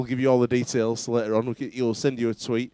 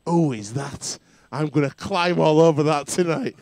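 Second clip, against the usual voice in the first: raised, louder mock leering quote; put-on excitement, pitched up; imitating excited admirer; 4 semitones higher, 6 dB louder, slower than usual. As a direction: act out his loud, eager reaction. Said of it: "he goes".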